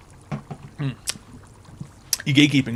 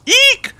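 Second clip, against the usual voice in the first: very high mock squeal; squeaky shriek; 5 dB louder than usual. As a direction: let out a tiny hammed-up eek. Mock fright in fake ad.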